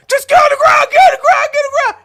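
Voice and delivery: high, loud, urgent repeated order; frantic imitated shouting; shouted police command. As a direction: yell it like a panicked cop. Acted police command in story.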